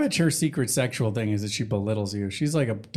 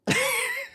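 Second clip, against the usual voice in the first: high squeal laugh. Real, not acted. Laugh after joke.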